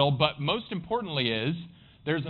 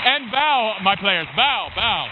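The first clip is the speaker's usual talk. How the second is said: raised, commanding master-of-ceremonies call; loud stage call; 6 semitones higher, 8 dB louder, slower than usual. Real, not acted. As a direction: call the bows out loudly over applause. Calling players to take bows.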